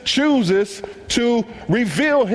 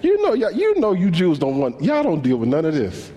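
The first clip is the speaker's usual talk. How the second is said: much higher, indignant sass; sassy woman; 4 semitones higher than usual. Pretend. Woman at the well brushing off Jesus, high sassy voice. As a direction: push back like a sassy woman. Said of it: "She said".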